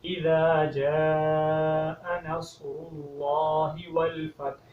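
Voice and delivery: slow melodic tilawat with a clear final h on والفتح; correct recitation. Shown as a demonstration. Teacher models the corrected first verse in recitation. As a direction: recite the whole verse slowly, all three sounds right. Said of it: "پڑھنے کا انداز یہ ہے،".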